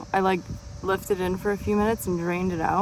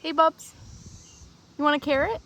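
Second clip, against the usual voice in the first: higher, sing-song voice for an animal; asking the pet, Bubs, if it wants a carrot, in a higher, cooing voice; pet talk; 7 semitones higher, faster than usual. Real, not acted. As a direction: coax the pet sweetly in a higher voice. Talking to a pet.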